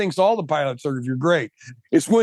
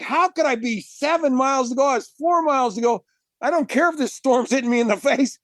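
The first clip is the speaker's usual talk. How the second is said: high, exasperated disbelief, retold with a laugh; comic retold outrage; incredulous protest; 7 semitones higher than usual. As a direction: argue with yourself in a high, amused voice. Retold incredulous thoughts during the swim.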